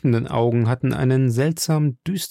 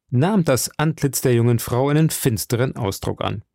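plain reading voice

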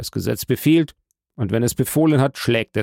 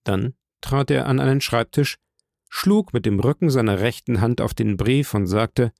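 usual speaking voice

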